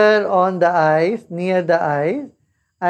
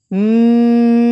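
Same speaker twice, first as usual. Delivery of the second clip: loud steady bee-like hum; bee humming; 3 semitones higher, 5 dB louder than usual. Shown as a demonstration. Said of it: "like this".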